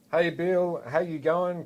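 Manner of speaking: calling out. Retold or imitated. Brother greeting Bill across the road, calling out. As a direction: hail a neighbour across the road.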